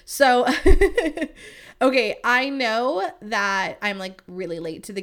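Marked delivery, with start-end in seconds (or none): 0.5-1.5 high, loud laugh. Real, not acted. Laughs after topic change.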